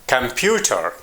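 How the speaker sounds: "computer" stressed on PU, falling after; "computer" as com-PU-ter, a careful word demo; stress on middle syllable. Shown as a demonstration. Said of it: "In the middle".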